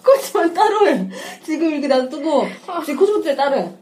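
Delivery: high, loud giggling; falling apart laughing. Real, not acted. Laughing fit during recording.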